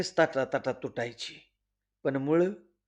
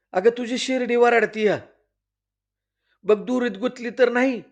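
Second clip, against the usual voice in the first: urgent warning; 7 semitones higher, 3 dB louder than usual. Acted. Aunt warns Hira urgently.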